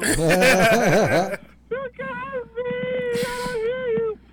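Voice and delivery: high, very slow laughing words; laughing squeal. Retold or imitated. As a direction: crack up while saying it. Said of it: "laughing high".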